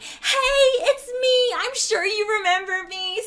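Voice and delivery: high, drawn-out mock greeting; put-on customer greeting. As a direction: imitate a pushy customer in a high voice. Customer greets the manager, retold.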